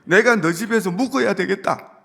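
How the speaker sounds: Jesus speaking like the owner to Zacchaeus; like the master of the house. Shown as a demonstration. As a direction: announce it as if it were his own home. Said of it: "꼭 자기 집처럼".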